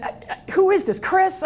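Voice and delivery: stammer, then a startled pitch jump; baffled question. Retold or imitated. Speaker on an early phone call, confused and startled.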